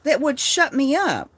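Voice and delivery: loud, high, heated rant at the critics. Real, not acted. Ranting at the people digging for dirt, loud and heated. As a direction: raise the voice and push every word out.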